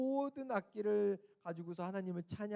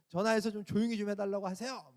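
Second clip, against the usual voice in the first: mock complaint; 5 semitones higher, 5 dB louder than usual. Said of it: "막 이러면서".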